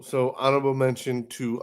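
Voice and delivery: ordinary delivery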